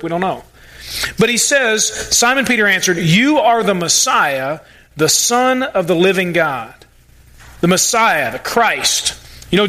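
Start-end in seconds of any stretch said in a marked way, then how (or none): 3.0-6.7 slow, weighty declaration; slow, reverent confession of faith; slow solemn confession. Acted. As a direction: lay each word down slowly, with reverence. Peter's confession quoted.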